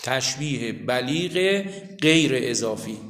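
slow dictation with long pauses. Real, not acted. Teacher telling students what to write under the line, slow dictation. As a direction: dictate word by word, drawing each one out. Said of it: "زیر اون خط بنویسید".

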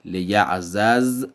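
لیعزز said slowly as "li-ya'-zaz", two z sounds apart; slow, separate zz in لیعزز; لیعزز read unmerged; Arabic unmerged. Shown as a demonstration.

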